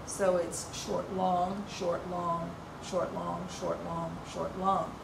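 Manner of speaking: "short, long" spoken as an even, steady beat; short-long rhythm. Shown as a demonstration. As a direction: tick out "short, long" slowly like a metronome. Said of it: "unstressed syllable followed by a stressed syllable".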